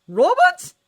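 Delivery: very high, loud disbelieving cry; John recognizing an old friend, stunned; shocked gasp of a name. A narrator's reading. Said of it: "John breathed, shock and raw emotion".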